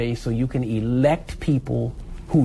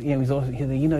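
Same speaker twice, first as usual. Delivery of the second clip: ordinary delivery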